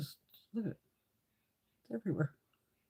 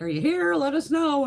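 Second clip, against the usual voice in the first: high, louder call to the chat; hosts calling out; 9 semitones higher, 5 dB louder than usual. Retold or imitated. Hosts calling to absent giveaway winners, calling out.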